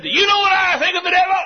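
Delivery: put-on fiery young preacher bellowing about the devil; preacher's shout. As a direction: challenge the congregation like a ranting preacher. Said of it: "He said".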